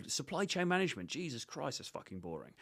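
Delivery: dry mock disdain, dropping away at the end; mock unimpressed. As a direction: rib her for the boring job. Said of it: "What I do here is I go".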